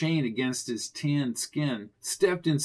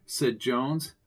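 plain reading voice

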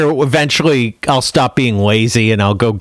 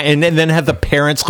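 ordinary talk, nothing marked

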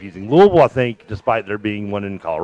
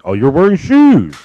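loud, slow, higher mock coworker voice; 7 semitones higher, 9 dB louder, slower than usual. Mocking Columbus coworkers' shoe jokes, loud and slow. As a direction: drag out the taunt like an annoying coworker.

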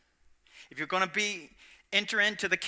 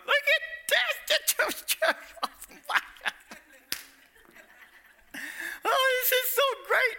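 very high, slow, tearful gushing voice; delighted heavenly onlooker; slower than usual. Retold or imitated. The Father in heaven watching his children, gushing high. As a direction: melt with delight at the kids. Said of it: "They're going".

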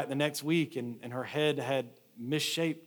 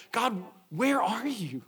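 desperate plea to God; 8 semitones higher, slower than usual. Acted. Acted cry to absent God.

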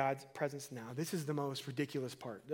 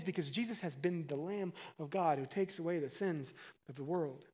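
ordinary talk, nothing marked

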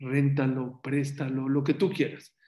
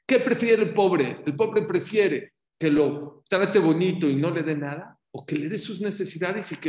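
loud, higher challenge; challenging question; 4 semitones higher, 7 dB louder than usual. Retold or imitated. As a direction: demand an answer. Interrupter's challenge continues. (alumno reta a la maestra en voz alta)

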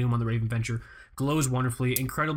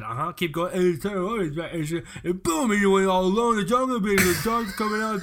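Stallone impression; 3 semitones higher than usual.

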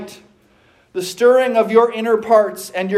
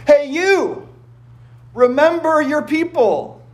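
a much higher mock shout up at God; almost shouting; 7 semitones higher than usual. Isaiah nearly shouting at God, higher mock shout. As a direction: call out to God like a half shout. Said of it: "to almost shout at him".

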